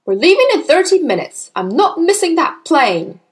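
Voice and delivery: harried, angry dad; shouting. Acted. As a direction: lay down the law forcefully. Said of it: "the father shouted".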